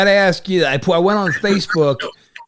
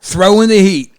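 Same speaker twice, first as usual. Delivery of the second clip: said again much louder and clearer; louder repeat; 2 semitones higher, 7 dB louder than usual. Real, not acted. Host repeats the phrase louder after the guest asks what. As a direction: push the phrase out so he hears it. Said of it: "The what?".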